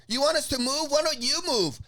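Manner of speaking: lightly caricatured high retort; mock indignant woman. Woman arguing back at fighter, mock indignant. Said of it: "And she goes".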